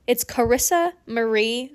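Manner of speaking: slow, carefully spaced name with a rise at the end; slow and clear. Shown as a demonstration. Host showing listeners the right way to say her name, slow and clear. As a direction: spell out each syllable of the name. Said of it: "I have to say my name like that".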